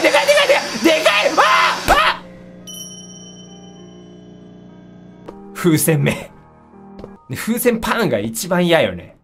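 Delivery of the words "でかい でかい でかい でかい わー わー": high panicked screaming; hit hard crying out. Real, not acted. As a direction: shriek in terror. Screaming as thing grows.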